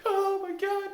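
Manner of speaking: mock frantic cry for a hug; over-the-top plea. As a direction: ham up begging a coworker for a bear hug. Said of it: "go up to your coworker and say".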